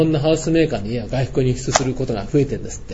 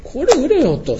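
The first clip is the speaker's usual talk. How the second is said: 命令口調; 10 semitones higher, slower than usual. Retold or imitated. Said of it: "言ったんですね".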